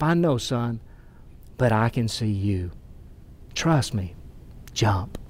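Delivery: low, hushed voice of a steady dad; reassuring father. Retold or imitated. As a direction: retell it gently and low, with pauses between phrases. Father reassures frightened son.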